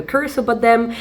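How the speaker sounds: ordinary delivery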